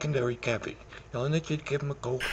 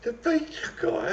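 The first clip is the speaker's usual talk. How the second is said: pitched way up and drawn out; shocked disbelief; 11 semitones higher than usual. Acted. Drunk caller realizes he reached the pizza guy, stunned. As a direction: gasp it out in disbelief.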